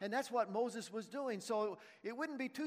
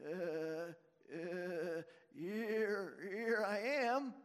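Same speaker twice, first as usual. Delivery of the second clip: sheepish; much slower than usual. Acted. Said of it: "More sheepish than shepherd".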